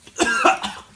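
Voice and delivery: sudden coughing fit from the heat; coughing from hot pepper; harsh cough. Real, not acted. Coughing after eating hot pepper.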